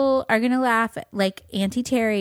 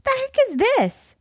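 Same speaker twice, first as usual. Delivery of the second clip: very high shocked exclamation; startled squeak. Retold or imitated. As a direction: squeak it in disbelief. Finding nails spilled on patio.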